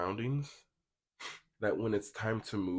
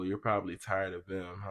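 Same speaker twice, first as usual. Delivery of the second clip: usual speaking voice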